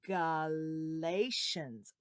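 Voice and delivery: Galatians said very slowly, syllable by syllable; slow spelled-out word. Shown as a demonstration. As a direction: draw out "Galatians" slowly for kids to copy. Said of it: "this is a tough name to learn".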